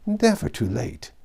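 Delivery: cheerful and high, smiling through it; genial. A narrator's reading. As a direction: beam as you say it. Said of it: "smiled his most genial smile".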